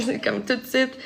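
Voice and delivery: normal talking voice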